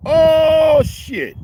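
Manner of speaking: high, loud oh shit; a loud whoop of excitement for his people; excited exclamation. Real, not acted. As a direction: shout it out with delight. Hyping his Vietnamese people.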